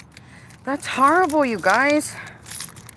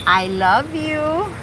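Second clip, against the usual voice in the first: cooing to car; much slower than usual. Real, not acted. To her car as it starts, slow tender cooing. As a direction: coo it like to a pet.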